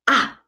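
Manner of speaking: short "ah" dropping sharply; falling tone. Shown as a demonstration. Teacher showing fourth tone, "ah" falling sharply. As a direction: cut "ah" short with a steep fall. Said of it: "the falling tone. It goes down".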